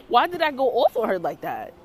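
usual speaking voice

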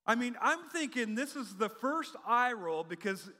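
ordinary delivery

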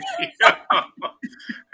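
big high cackling laugh; loud burst of laughter. Real, not acted.

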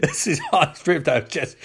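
usual speaking voice

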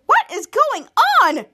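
high, loud, drawn-out disbelief; acted exclamation. The toddler reacts to the scene, loud mock disbelief. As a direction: imitate a baffled outburst, stretched out. Acted character demanding what is happening.

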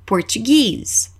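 "Português" said as English "Portuguese", long stressed "-GUESE"; slow "Português" as por-chu-GEEZ, weight on the end; English final stress. Shown as a demonstration. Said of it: "nós damos ênfase no final".

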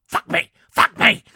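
loud possessed shout; demonic yelling. Retold or imitated. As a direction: scream it like a demon. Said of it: "She's Mercedes McCambridge".

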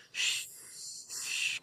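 soft, long shush; soothing shush. Retold or imitated. Kid soothing a spooked horse, soft shushing. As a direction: hush the horse gently.

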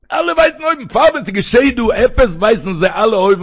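sudden high, loud outburst; loud, fervent exclamation from the pulpit; impassioned preaching. Acted. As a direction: burst out in a high preacher's cry.

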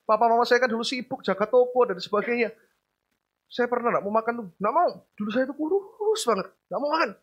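usual speaking voice

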